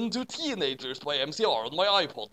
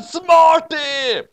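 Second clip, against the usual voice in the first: much higher, very loud shout; 13 semitones higher, 10 dB louder than usual. A narrator's reading. Narrator shouting, very loud and high. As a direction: blast it out.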